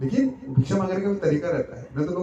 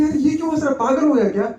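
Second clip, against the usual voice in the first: higher and louder puzzled question; astonished onlooker; surprised; 8 semitones higher, 5 dB louder, faster than usual. Acted. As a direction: ask in disbelief why he's laughing. Animals puzzled by donkey laughing.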